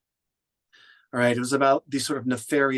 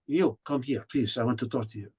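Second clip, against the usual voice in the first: Poirot-style French accent, trailing off softer; French or Belgian accent; 4 dB quieter than usual. Shown as a demonstration. Poirot calls a woman over to talk, French accent. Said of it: "he's ducking in his French accent, or Belgian".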